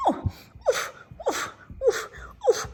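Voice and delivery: high, loud puffing oofs; dog puffing. Acted. Dog pushing through bushes, high puffing oofs. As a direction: push through the bushes with effort.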